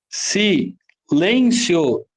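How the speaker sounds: "Silêncio" very slowly split as Si - lên - cio; slow, separated syllables in "Silêncio". Shown as a demonstration. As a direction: count out "Silêncio" in three chunks. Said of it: "Três sílabas".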